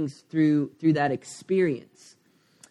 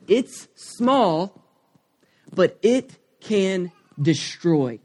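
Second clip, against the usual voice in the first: mysterious dream voice; 3 semitones higher, much slower than usual. Retold or imitated. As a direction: deliver it like an ominous message from a dream, slow.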